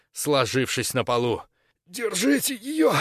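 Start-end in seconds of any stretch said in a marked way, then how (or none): 1.8-2.9 hoarse strained whisper, high and slow; raspy whisper. A narrator's reading. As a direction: rasp it out weakly, slowly. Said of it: "просипел Фурадор".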